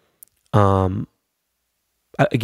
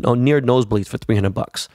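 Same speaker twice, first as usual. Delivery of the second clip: ordinary talk, nothing marked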